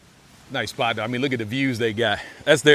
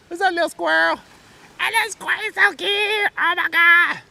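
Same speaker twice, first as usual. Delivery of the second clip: cutesy squeal; 4 dB louder than usual. Real, not acted. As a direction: gush over how cute it is. Gushing over cute squirrel.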